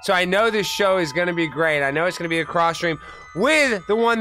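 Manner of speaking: ordinary delivery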